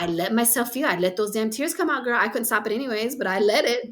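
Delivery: ordinary delivery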